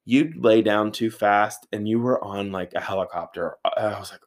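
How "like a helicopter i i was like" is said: usual speaking voice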